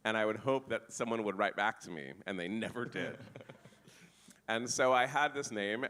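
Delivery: ordinary talk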